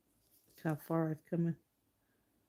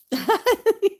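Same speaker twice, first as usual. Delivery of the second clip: loud high laugh; high burst of laughter; 10 dB louder than usual. Real, not acted. Artist laughing at her own painting, high loud laugh. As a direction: let a big bright laugh out.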